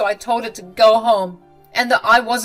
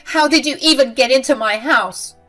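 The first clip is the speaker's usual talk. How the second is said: loud, higher indignant demand; angry; 7 semitones higher, 8 dB louder than usual. Acted. Mother-in-law angrily demanding how Macy got in. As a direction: demand an answer, outraged.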